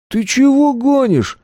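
very high, slow, disbelieving questions; incredulous challenge. A narrator's reading. Attackers challenge the hero's claim.

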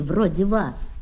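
plain reading voice

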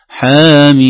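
حاميم chanted loud with the vowels drawn long; reciting حاميم for the students; recited elongation. Shown as a demonstration. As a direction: sing out حاميم with the long madd. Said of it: "نمد هكذا".

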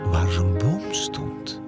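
ordinary delivery

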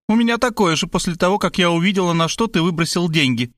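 high, lamenting mother; lightly voiced motherly grievance; complaining. A narrator's reading. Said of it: "посетовала она".

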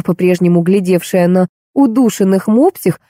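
ordinary delivery, nothing marked

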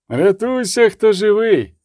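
high, urgent shout; barked warning. A narrator's reading. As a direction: bark it while racing past. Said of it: "гаркнув, пролітаючи повз нього".

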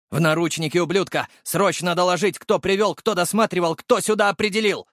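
high-pitched officer's orders; urgent commands. A narrator's reading. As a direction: fire off orders to subordinates. (командный крик на повышенных тонах)

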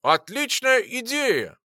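put-on slow, slurred drunk cheer. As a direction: slur it out tipsy. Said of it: "уже совсем пьяным голосом сказала Лара".

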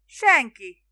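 one loud called-out word; shouted back. A narrator's reading. Boatman answering Spiro from afar, shouting. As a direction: call it back over the water. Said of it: "kiáltotta vissza".

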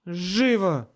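loud, high bark; barked order. A narrator's reading. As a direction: bark it as a command. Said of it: "рявкнула Мэрил". (рявкая)

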